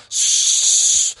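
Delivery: loud breathy rush of air; whispered whoosh. Acted. The drain sucking down the dog, breathy whoosh. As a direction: hiss like water draining away.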